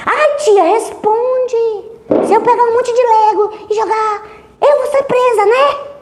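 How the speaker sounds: put-on girl pressing the psychologist for an answer; pleading child. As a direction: beg like a stubborn child. Girl insisting, voiced high.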